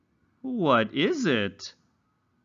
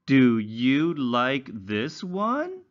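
slow, high, sing-song storybook reading; reading to children; 3 semitones higher, much slower than usual. Acted. Teacher reading a simple story to young English learners, slow and sing-song. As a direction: read it slowly like a picture book for little ones.

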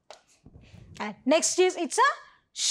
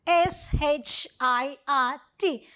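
spelling aloud; 4 semitones lower than usual. Shown as a demonstration. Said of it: "let's complete the words first".

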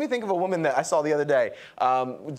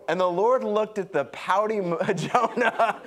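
laughing; 5 semitones higher, slower than usual. Real, not acted. Laughing at pouty Jonah.

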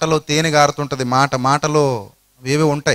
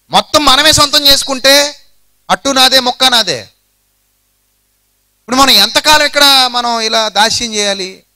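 scheming servant; 5 semitones higher than usual. Acted. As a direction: grumble and plot like a grasping servant. Ziba's scheming inner voice.

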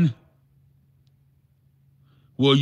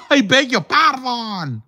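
mock offended; 9 semitones higher than usual. Mock-indignant catchphrase at a viewer.

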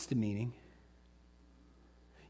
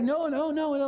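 high, louder, urgent pleading; struggling parents; 13 semitones higher, 5 dB louder than usual. Retold or imitated. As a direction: imitate parents desperately stopping a toddler. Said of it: "they're really struggling".

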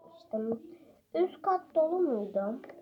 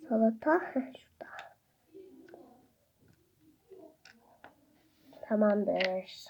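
whispering; 5 dB quieter than usual. Real, not acted. Whispered while playing.

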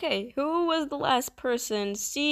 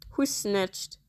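usual speaking voice

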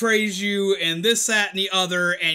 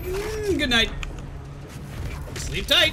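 mock bedtime; 8 semitones higher, slower than usual. Mock tucking in a killed enemy.

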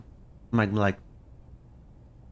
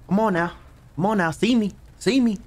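higher and higher nervous pleading; rising panic; 9 semitones higher than usual. Real, not acted. Scared gamer bracing himself, rising high panic. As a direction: work up the nerve, panicking.

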